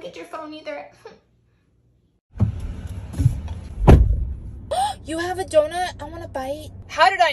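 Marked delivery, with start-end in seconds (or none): 2.3-5.0 excited gasp. Acted.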